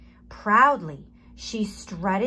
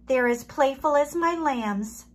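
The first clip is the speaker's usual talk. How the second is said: sheep voice; 5 semitones higher, 5 dB louder than usual. A narrator's reading. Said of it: "baaed Sally".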